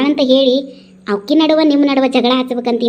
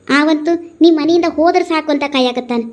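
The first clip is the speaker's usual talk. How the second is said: ordinary talk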